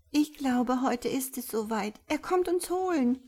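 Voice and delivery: high, anxious little creature's voice; tearful fear. A narrator's reading. Bibi in Tränen, Angst. (ängstlich, den Tränen nahe, hohe Stimme)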